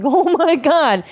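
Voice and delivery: high, slow stunned "oh my God"; a shocked exclamation; shocked gasp. Retold or imitated. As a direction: recoil at what you see. Shocked at pills in car.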